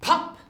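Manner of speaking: popping sound. A narrator's reading. Elf reappearing sound.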